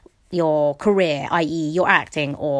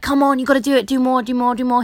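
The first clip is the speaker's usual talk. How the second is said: strict trainer; 5 semitones higher, faster than usual. Retold or imitated. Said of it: "a really strict trainer".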